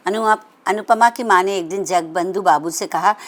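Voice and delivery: usual speaking voice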